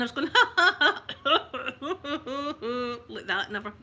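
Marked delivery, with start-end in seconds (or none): 0.3-3.0 loud, high run of mock giggles; imitated giggling fit. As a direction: act out uncontrollable giggling. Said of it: "the giggling".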